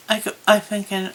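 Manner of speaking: usual speaking voice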